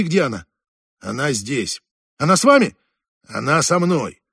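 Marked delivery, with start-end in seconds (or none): 2.2-2.8 raised voice. Acted. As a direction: ask it loudly, almost shouting. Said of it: "Не кричите так".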